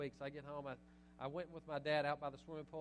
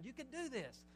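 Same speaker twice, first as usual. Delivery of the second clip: egging on; 8 semitones higher than usual. Shown as a demonstration. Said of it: "It means to egg on".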